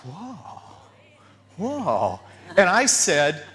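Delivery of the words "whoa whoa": soft, drawn-out amazement; acted quiet wonder; awed whoa. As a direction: breathe it out, stunned. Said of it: "And I'm like".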